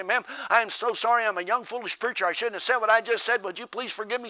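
loud, high earnest plea; young preacher begging the lady, loud plea; pleading apology. Retold or imitated. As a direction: beg her forgiveness.

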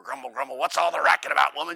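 loud gruff grumbling; grumble, annoyed at being woken; husband woken by sobbing, loud grumble; grumpy husband. Retold or imitated.

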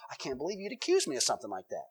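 soft, fast mock indignation; mock offended child. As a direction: play the wronged innocent, hurt and quick. Innocent child acting offended.

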